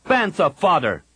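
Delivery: snapped. A narrator's reading. Jacen brushing off Kip's claim, snapping. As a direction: spit it out, cutting him off. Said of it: "Jacen snapped".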